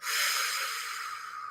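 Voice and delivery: hard breath out like blowing candles. Shown as a demonstration. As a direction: blow out all the air hard. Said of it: "blow out like you're blowing out birthday candles, hard like this".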